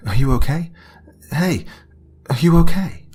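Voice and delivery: woman shouting to the screaming man outside her car; panicked calling. A narrator's reading. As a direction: shout through the window in alarm. Woman calls to injured man.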